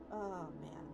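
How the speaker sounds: ordinary delivery, nothing marked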